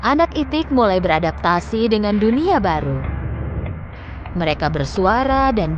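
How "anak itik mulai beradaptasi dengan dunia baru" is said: ordinary delivery, nothing marked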